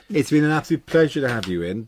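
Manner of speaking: ordinary talk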